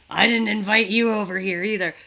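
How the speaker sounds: loud, grumpy imitation; crotchety. Walt to uninvited neighbours, retold, crotchety. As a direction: grouse at them like a mock curmudgeon. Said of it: "like, he's so crotchety".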